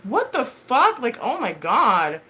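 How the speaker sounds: high, loud exasperated exclamation; exasperated shock, acted out; retold outraged disbelief. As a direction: jump up high, then drag it out. Retells reaction to being ganged up on.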